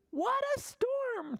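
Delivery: very high, twittering bird; twittering Wren. Acted. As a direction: chirp it like a little wren. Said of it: "twitters Wren".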